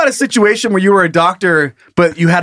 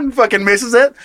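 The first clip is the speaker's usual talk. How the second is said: ordinary talk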